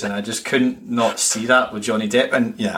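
ordinary talk, nothing marked

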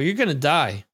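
ordinary delivery

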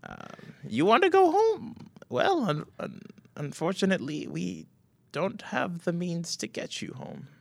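a frail grandmother chuckling; old woman. Acted. Old woman to Wesley, high and frail. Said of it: "the old woman kinda chuckles".

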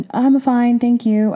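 high, bright, forced-cheerful brush-off; fake politeness. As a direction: chirp it like everything is fine. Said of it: "I'm just like".

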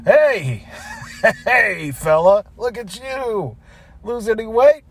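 hearty hello with a chuckle; chummy, put-on cheer; fake friendly greeting. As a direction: greet him like an old buddy. Fake friendly greeting to hated coworker.

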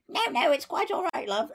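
put-on high, dignified posh old lady voice. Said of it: "she's still being really posh".

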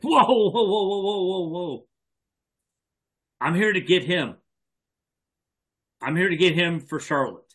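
high, urgent whoas that settle down; alarmed warding off. Acted. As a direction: hold back gunmen, starting high and calming. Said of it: "I'm like".

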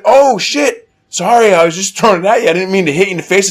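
high, loud imitation of a girl; startled apology. Girl apologizing to boy she hit, mock alarm. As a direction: blurt a mock-panicked sorry.

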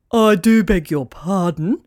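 slow, haughty pelican voice; offended pelican retort. Acted. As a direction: draw it out like an insulted grand bird. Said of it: "retorted Plop".

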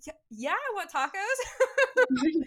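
high, loud and drawn-out slow yes; overjoyed stretched-out yeah; eager yes to tacos. Retold or imitated. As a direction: gush a slow, delighted yes, like tacos are the best news all day. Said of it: "I was like".

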